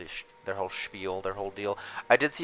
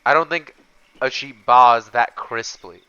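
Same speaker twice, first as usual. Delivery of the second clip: loud, slow amused protest; slow, loud and incredulous; loud disbelief; 3 semitones higher, 14 dB louder than usual. Real, not acted.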